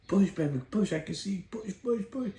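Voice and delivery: put-on dad urging his baby, high and excited; excited coaching. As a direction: spur her on, rising high.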